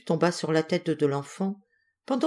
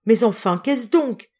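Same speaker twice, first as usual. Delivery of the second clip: higher, louder puzzled demand; baffled, raised-voice question; urgent questioning; 5 semitones higher, 5 dB louder than usual. A narrator's reading. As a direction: press him for an explanation. Wife questions her laughing husband.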